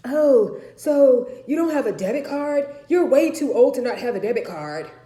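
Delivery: mocking grandmother. Grandmother needling her daughter about a debit card, scornful. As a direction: sneer it condescendingly.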